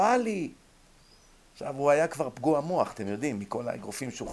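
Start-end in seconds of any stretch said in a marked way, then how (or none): 0.0-0.5 retold calling out. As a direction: imitate a friend hailing someone. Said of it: "החבר שלי צועק לו".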